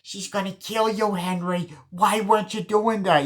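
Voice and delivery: Joe Pesci. Retold or imitated. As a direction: imitate a fast-mouthed film gangster's high voice. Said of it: "Joe Pesci's there".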